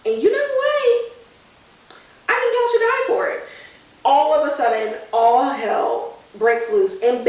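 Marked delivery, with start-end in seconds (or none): 0.1-3.4 put-on high, menacing ghost-boy threat; trapped boy threatening the friends who abandoned him; vengeful ghost. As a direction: turn sinister and pronounce their doom. Ghost Maddie turns on friends.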